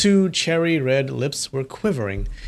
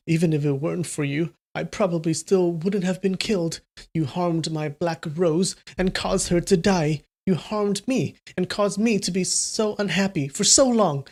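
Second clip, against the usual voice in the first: higher voiced girl throwing an upset fit; tearful angry girl; 4 semitones higher than usual. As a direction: pour out her grievances, higher and tearful. Voices Mu Wanqing.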